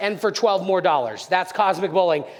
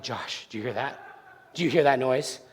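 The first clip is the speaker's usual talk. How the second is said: retold scared kid, very low and hushed; nervous hush; 11 semitones lower, 6 dB quieter than usual. Scared boy to his friend while teepeeing a house, nervous hush. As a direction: ask under your breath, as if someone might hear.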